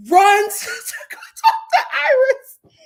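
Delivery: ordinary talk, nothing marked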